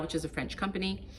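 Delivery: ordinary talk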